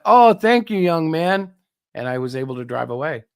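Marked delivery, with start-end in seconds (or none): 0.0-1.5 high, effusive mock thank-you; retold grateful thanks. Stuck driver thanking the twelve-year-old who helped, effusively grateful. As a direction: exclaim your thanks, starting high and loud.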